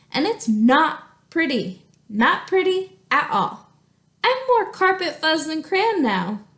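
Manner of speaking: reading a letter aloud in a lively voice; story reading. Acted. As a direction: read the crayon's letter expressively to the class. Teacher reads crayon letter aloud.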